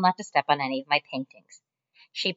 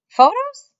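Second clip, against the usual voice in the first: much higher, loud surprised squeak; 9 dB louder than usual. Acted. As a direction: jump at the word. Mac surprised.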